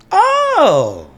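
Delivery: high delighted squeal. Pretend. Acting delighted reaction.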